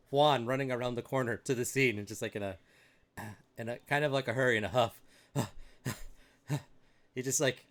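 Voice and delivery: usual speaking voice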